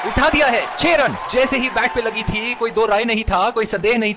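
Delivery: excited. Acted. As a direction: shout the six with delight. Six hit, commentator erupts.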